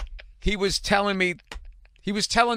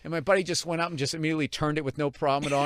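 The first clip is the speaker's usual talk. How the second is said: normal talking voice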